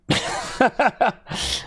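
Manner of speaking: high-pitched burst of laughter; real laugh. Real, not acted. Streamer reading William's boast of being right, high laughing.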